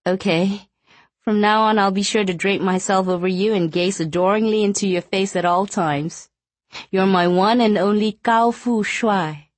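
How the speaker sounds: playful wife, mock adoring; young woman voice. Wife teasing husband.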